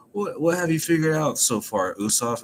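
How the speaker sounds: a hint of Nami asking, puzzled. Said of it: "and Nami asks".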